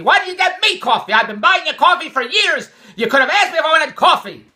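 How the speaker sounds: loud, high-pitched mock yelling; imitated hollering. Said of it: "Andrea starts hollering at me".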